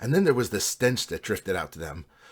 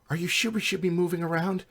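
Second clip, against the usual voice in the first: higher, uneasy character voice; uneasy questioning; 9 semitones higher than usual. A narrator's reading. Jill asks the party if they should be moving, uneasy. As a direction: voice a nervous party member, pitched up.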